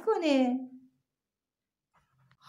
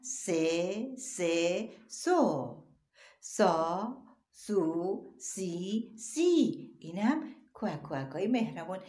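normal talking voice

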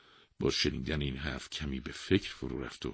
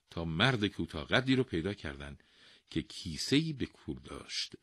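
plain reading voice

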